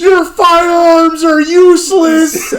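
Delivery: very high, loud, slow yell; hammed-up panic; mock frantic shout. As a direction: scream a warning at the cops. Acting bee-attack panic.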